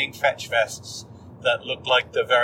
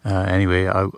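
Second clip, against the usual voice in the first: peaceful nature report; 6 semitones lower than usual. Real, not acted. Calm nature vlog.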